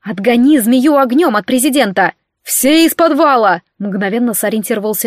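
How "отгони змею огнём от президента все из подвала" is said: urgent battle commands; urgent shouted orders. Acted. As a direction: call out orders in an emergency. Shouted orders amid snake attack.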